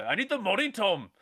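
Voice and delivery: high-pitched imitation Northern Irish accent; mock Irish movie line; Brad Pitt's Irish accent. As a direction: mimic a film star's shaky Irish accent. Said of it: "Brad Pitt's Irish accent".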